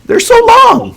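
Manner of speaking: high squeaky little creature voice. Acted. Creature squeaking about its long ears.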